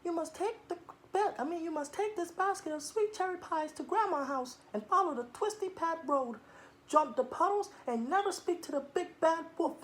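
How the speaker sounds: soft, high mother; mother's voice. Acted. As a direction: speak as the mother giving instructions. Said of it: "her mother said".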